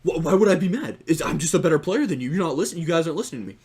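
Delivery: worked-up, loud mock indignation; mad. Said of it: "you're kinda mad for no reason".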